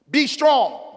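loud, high preacher's cry of God's words; booming pulpit proclamation; full-force preaching. Acted. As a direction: preach it out, rising and ringing. Preached command.

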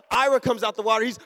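ordinary delivery

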